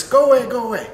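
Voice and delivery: high, loud panicky "go away"; imitating a frightened plea; nervous. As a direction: push him off in a retold panic. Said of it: "she gets all nervous".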